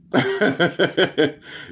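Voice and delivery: high gloating cackle; mocking laugh. Real, not acted. Gloating after taunt.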